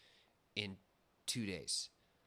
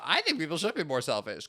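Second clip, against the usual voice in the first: mock selfish motto; 6 semitones higher, 7 dB louder than usual. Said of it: "kind of angle".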